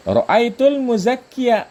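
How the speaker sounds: ra'aitul muzakkiya read slowly and high, with the final ya clearly sounded; careful Arabic reading. Shown as a demonstration. Teacher showing students how the mansub form is read, slow and high. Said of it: "Jadi ketika mansub bacanya ra'aitul muzakkiya".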